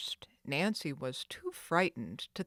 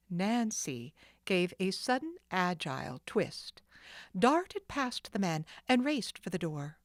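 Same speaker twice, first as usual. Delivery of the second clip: plain reading voice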